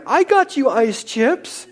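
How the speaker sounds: high, slow, lightly voiced proud husband; mock proud husband. Husband boasting to his wife in labour, high and slow. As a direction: play the husband pleased with his small help.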